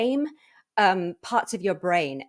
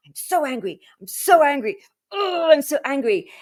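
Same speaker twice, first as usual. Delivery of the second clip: starts mildly cross, swells to a loud, higher angry cry; building anger; 7 semitones higher, 6 dB louder than usual. Shown as a demonstration. Guest demoing letting anger rise, building to loud. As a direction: let the anger bubble up louder each time. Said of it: "you sit there and you go".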